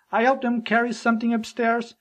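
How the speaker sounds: ordinary talk, nothing marked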